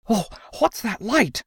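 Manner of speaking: high, gasping, frightened question; breathless gasp. Acted. Crockett, dragged along running, asks: high breathless gasp. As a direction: gasp it out, half panicked. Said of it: "Crockett managed to gasp".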